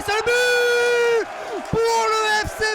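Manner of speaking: loud, drawn-out goal shout; goal call. Real, not acted. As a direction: belt out the goal. Second goal called. (cri de but prolongé)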